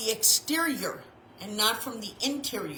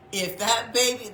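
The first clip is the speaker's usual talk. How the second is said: normal talking voice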